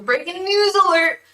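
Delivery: high, loud mock news-alert call; breaking news. Streamer announcing church pot-farm bust to viewers, mock breaking news. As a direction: blare it out like an urgent bulletin. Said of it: "so we got a breaking".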